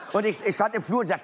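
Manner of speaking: ordinary talk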